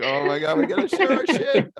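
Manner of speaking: high, loud, laughing gush; excited gushing. Retold or imitated. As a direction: gush like an eager couple. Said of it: "they're like".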